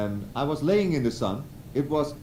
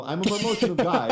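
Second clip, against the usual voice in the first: laughing admission; 9 semitones higher, 4 dB louder, faster than usual. Real, not acted. Laughing while admitting he cried.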